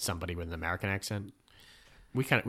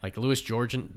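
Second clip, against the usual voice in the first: usual speaking voice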